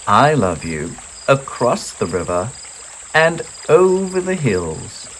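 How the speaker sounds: broad and generous; father hare outdoing his son; big hare voice. A narrator's reading. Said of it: "said Big Nut Brown Hare".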